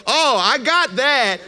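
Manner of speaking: high, loud, drawn-out realization; sudden understanding. Acted. Listener getting the blunt prophecy, exaggerated excited realization. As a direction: cry out as if it finally lands.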